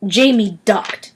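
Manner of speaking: usual speaking voice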